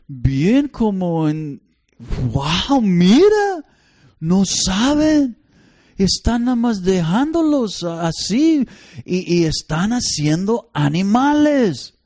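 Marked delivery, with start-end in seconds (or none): 2.3-11.9 higher, incredulous exclaim; amazed disbelief. Retold or imitated. Couple watching careless parents at Costco, amazed. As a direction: marvel at the chaos in disbelief.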